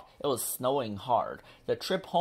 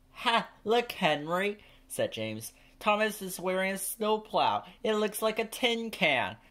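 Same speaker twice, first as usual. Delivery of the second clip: James, higher and teasing, laughing at Thomas; mocking engine voice; 8 semitones higher than usual. A narrator's reading. James teasing Thomas about his snowplow, higher mocking voice. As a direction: jeer at the tin can, high and cheeky.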